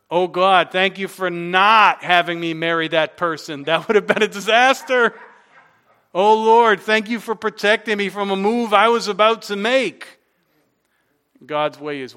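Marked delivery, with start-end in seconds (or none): 0.1-10.2 acted testimony, loud and exclaiming; fervent thanks. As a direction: cry out thanks like a relieved churchgoer. Acted grateful testimonies from congregation.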